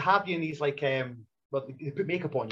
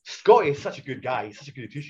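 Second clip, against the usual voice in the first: ordinary talk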